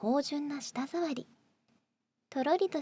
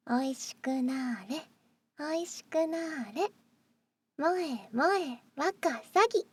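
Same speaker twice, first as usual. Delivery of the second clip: sugary maid cafe greeting; 2 semitones higher than usual. Acted. Maid cafe spell chant.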